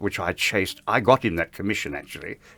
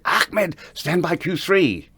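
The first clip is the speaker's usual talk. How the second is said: higher, slow, insistent call to the switchboard; retold urgent cue call; 10 semitones higher, much slower than usual. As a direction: call the cue across the stage, pressing. Calling the cue to Ahmed.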